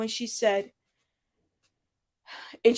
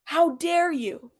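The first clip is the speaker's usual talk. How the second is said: slow, higher-pitched mock affront; stretched, deadpan mock outrage; stone-faced indignation; 5 semitones higher, much slower than usual. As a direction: imitate her "how dare you", drawn out and deliberate. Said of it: "I was trying to say the stone face".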